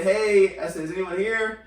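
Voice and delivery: loud call into an empty room. Retold or imitated. Calling into the shop's back room for the employee, a loud call. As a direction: call out to someone out of sight.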